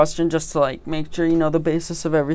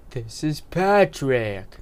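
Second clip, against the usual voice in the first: Patrick impression; much slower than usual. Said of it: "That was my Patrick impression".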